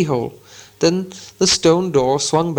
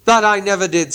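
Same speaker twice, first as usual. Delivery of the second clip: protesting troll; 5 semitones higher than usual. Acted. Tom denying it, mild troll protest. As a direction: flatly deny. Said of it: "said Tom".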